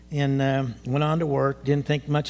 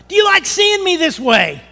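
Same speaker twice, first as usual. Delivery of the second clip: high, loud angry yell at God; retold yelling at God; 13 semitones higher, 7 dB louder than usual. Retelling his yell at God in pain, hurt angry shout. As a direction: cry out in anger, pleading. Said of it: "ever yelled at God".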